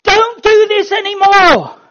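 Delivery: high, loud, slow pleading shout; slow, loud desperate plea; urgent plea. Shown as a demonstration. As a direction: beg them to stop, loudly.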